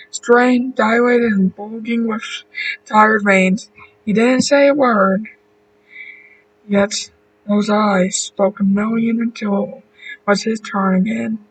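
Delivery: usual speaking voice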